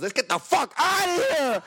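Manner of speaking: high, drawn-out retold yell; mock-panicked driver yelling; urgent getaway cry. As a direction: act out a frantic order to flee. Driver urging escape from crash.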